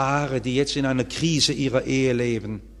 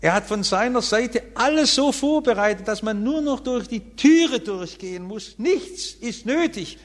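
preaching at full force; 8 semitones higher than usual. Acted. Preacher telling the congregation all is ready, fervent preaching. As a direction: stress that nothing more is needed. Everything prepared by the king.

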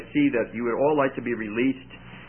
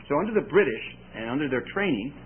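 ordinary talk, nothing marked

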